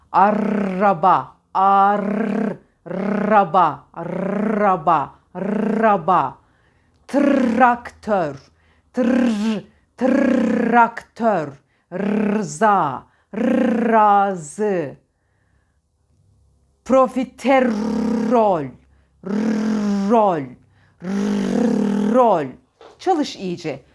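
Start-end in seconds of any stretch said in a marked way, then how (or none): 0.1-6.4 "Araba" with a very long trilled R, drawn out slowly; slow drill of "Araba", R heavily rolled; exaggerated rolled R. Shown as a demonstration. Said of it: "Dilini içeride titret". 7.1-14.9 every R in "Traktör", "Rıza", "razı" trilled long; exaggerated rolled R. Shown as a demonstration. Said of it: "Dilini içeride titret". 16.8-22.4 "Profiterol" slowed, with a long trill before "ol"; exaggerated rolled R. Shown as a demonstration. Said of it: "Dilini içeride titret".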